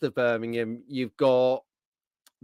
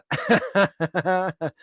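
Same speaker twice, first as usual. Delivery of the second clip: higher-pitched burst of giggles; real rattling laugh; 4 semitones higher than usual. Real, not acted. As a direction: crack up mid-sentence. Host cracks up mid-sentence.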